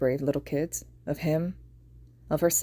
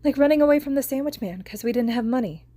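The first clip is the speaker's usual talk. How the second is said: put-on high little girl's voice; young girl, higher pitched; 8 semitones higher, 3 dB louder than usual. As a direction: voice a worried small girl.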